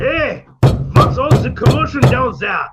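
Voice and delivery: loud call down from upstairs. Acted. Professor yelling down at the pounding below, loud call. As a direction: shout down the stairwell at the noise.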